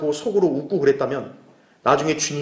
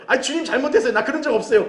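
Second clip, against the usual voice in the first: high, lightly acted plea; mock pleading apology; 8 semitones higher than usual. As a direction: beg off before the Lord, still amused. Acts believer pleading before the Lord.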